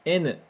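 "N" said the Japanese way, as "enu"; "N" pronounced "enu", not English "en"; Japanese letter N. Shown as a demonstration. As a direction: model "N" as "enu" for students to echo. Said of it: "Mais uma vez".

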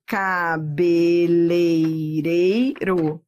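cabeleireiro pulled apart very slowly, syllable by syllable. Shown as a demonstration. Teacher splits cabeleireiro into syllables, very slow. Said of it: "Separe agora as sílabas".

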